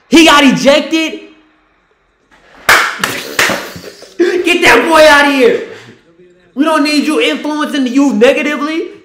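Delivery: high, loud gleeful yelling; gleeful shout. Real, not acted. Cheering a player's ejection, loud high gleeful yell. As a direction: crow over the ejection.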